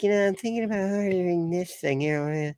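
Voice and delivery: high-pitched mock wife voice; wife's voice. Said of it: "why is that the voice that I went with to talk about my wife".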